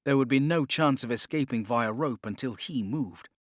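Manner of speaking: plain reading voice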